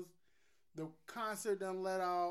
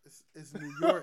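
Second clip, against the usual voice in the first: laughing imitation; 2 semitones lower, 9 dB louder than usual. Mocking friend's way of saying it.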